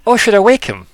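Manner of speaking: high, loud, eager question; Posey. Acted. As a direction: ask brightly, pitched well up. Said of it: "And Poe's like".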